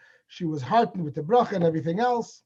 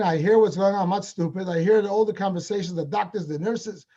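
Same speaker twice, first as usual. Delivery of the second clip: ordinary talk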